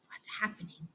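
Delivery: breathless hushed whisper. A narrator's reading. Teacher building suspense for the class, whispering. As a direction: whisper it like a secret.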